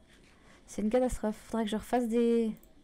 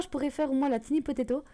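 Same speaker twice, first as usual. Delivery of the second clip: ordinary talk, nothing marked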